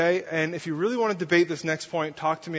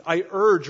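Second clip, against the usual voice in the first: "I urge" pushed out louder; emphatic urging; 6 dB louder than usual. Shown as a demonstration. Paul urging the church, emphatic and louder. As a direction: press hard on "I urge". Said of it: "a little bit more emphatic".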